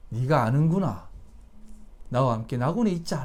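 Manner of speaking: low, softened voice of tender welcome; acted tenderness, low and hushed; gentle acceptance. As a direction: quietly reassure the dying man. Jesus gently accepting the robber.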